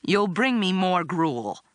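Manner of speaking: slow cross demand; cross, slow and measured. Acted. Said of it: "I said crossly".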